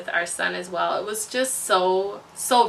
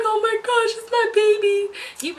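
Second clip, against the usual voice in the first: high, thrilled gasp of wonder; overjoyed squeal; 12 semitones higher, slower than usual. Retold or imitated. New mom squealing over her newborn, high and thrilled. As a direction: squeal it like a first-time mom in awe. Retelling first holding her son.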